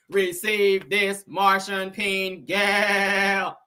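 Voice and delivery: slow, flat robot voice talking aggressively; robotic Martian. Retold or imitated. Host mocking a Martian robot voice, slow and robotic. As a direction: drag each word out like a bossy alien machine. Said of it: "Real robotic and shit".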